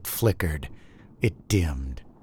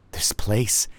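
softer, whispered aside; hushed; 5 dB quieter than usual. A narrator's reading. Narrator facing the remembered lighthouse, hushed whisper. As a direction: let it drop to a whisper.

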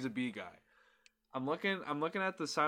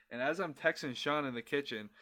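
ordinary talk, nothing marked

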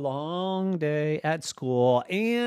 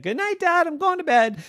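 put-on kid voice; 3 dB louder, faster than usual. Piper voiced.

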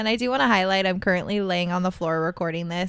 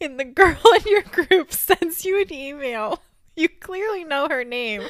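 high, loud, laughing through words; cracking up mid-sentence; laughing disbelief; 8 semitones higher, 5 dB louder than usual. Real, not acted. Laughing at embarrassing email story.

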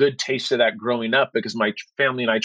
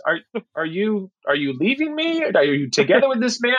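higher, mock alarmed wife; imitating wife; 6 semitones higher than usual. Wife asking if he's leaving her for a man, mock alarmed. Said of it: "My wife's like".

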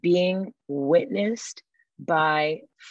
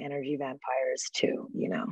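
breathy unvoiced hush, low and close; whisper; 4 semitones lower, 8 dB quieter than usual. Real, not acted. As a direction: whisper the question like a secret. Hushed closing thought.

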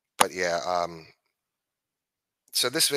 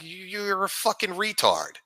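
ordinary talk, nothing marked

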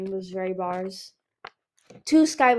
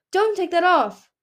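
panicked plea; 9 semitones higher, 8 dB louder than usual. A narrator's reading. Chameleon pleading in panic.

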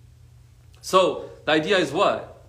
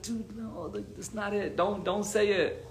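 much softer, higher-pitched urgent aside; hushed pleading warning; 5 semitones higher, 7 dB quieter, slower than usual. Retold or imitated. Warning a hyped friend in a tense room, hushed. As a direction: imitate shushing a friend under your breath.

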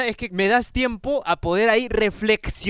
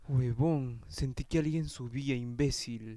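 much lower and softer, spooked; scared hush; 10 semitones lower, 14 dB quieter than usual. Real, not acted. Friend thinking someone climbed the stairs, spooked hush. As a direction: hush it, someone might be upstairs. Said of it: "me asusté".

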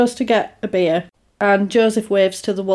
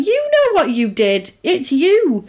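mock accusation; 5 semitones higher, slower than usual. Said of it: "you're like".

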